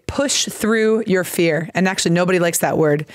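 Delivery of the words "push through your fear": slow and deliberate, x0.6; slow teaching phrase. Shown as a demonstration. To a Brazilian student, slow clear teaching read. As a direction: spell out the phrase for a learner. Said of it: "in English we say".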